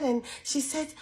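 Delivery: ordinary talk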